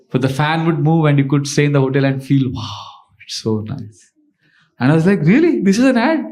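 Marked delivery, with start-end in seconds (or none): 2.5-4.0 hushed, low sigh of pleasure; blissful relief. Acted. Hotel guest relishing the fan's breeze. 5.2-6.2 high-pitched astonished questions; incredulous. Retold or imitated. Speaker reacting to the quaint advertisement.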